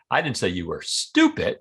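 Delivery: "stupid" set apart and punched at the end; stress on STUPID. Shown as a demonstration. Demonstrating how emphasis changes meaning, stress on "stupid". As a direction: save the emphasis for the last word, "stupid". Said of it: "by changing the word that I emphasize".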